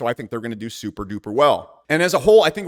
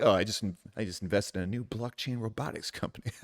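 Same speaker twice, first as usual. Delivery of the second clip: smug investor voice; 5 semitones lower, 11 dB quieter than usual. Retold or imitated. Mock smug investor quote.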